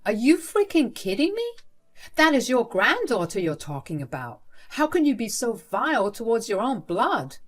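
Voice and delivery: loud, high outraged disbelief. Acted. Father confronting his mother about his daughter, outraged. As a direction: burst out in outrage.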